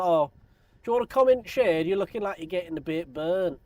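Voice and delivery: high, loud Northern English woman offering shade; kindly Northern English lass; northern accent. Retold or imitated. Woman offering shade, Northern English accent. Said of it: "had to deal with that accent".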